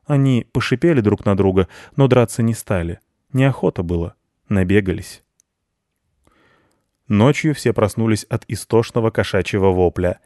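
usual speaking voice

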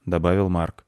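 usual speaking voice

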